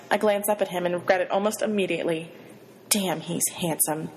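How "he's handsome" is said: ordinary delivery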